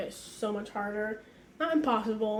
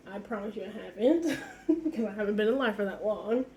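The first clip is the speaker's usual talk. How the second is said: normal talking voice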